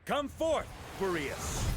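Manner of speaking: ringing call to summon a god. Acted. Calling on Boreas to appear, high ringing summons. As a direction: call him forth, high and commanding.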